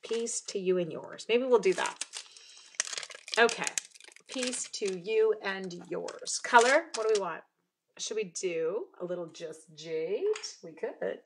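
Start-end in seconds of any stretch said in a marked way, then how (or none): 4.3-6.4 drawn out word by word; slow, measured. Real, not acted. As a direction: say it slowly, as if placing each word. Spelling out the chosen card phrase.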